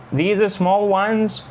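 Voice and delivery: "These are small ones?" said slowly, rising high at the end; rising question. Shown as a demonstration. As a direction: lift "ones" up sharply to check what was meant. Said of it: "Listen to how rise in intonation is used to ask for".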